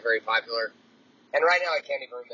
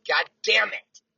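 a higher, louder frustrated curse, replayed; mock angry curse; 8 semitones higher, 5 dB louder, slower than usual. Cursing the slipping sock on his walk, mock angry. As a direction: spit it out like the sock beat you again. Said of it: "I'm going".

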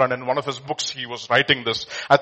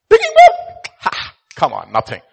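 scoffing; 9 dB louder than usual. Acted. Emperor scoffs at small request.

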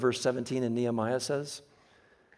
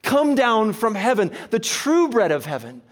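louder, higher, building sermon voice; preaching at full force; 5 semitones higher, 7 dB louder, slower than usual. Acted. Preacher building to a climax about Jesus, louder. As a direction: drive the point home to the congregation.

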